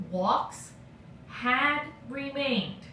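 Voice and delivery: had remained slowly and deliberately, with weight on had; slowly read out. Shown as a demonstration. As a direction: read the verb phrase aloud slowly, as in a dictation. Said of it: "Okay, verb phrase".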